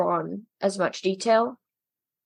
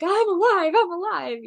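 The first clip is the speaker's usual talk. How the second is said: high, breaking-out cry of relief; high, hammed-up relieved exclamation; desperate relief; 9 semitones higher than usual. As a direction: imitate someone overjoyed to be alive. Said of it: "desperately relieved to be alive again".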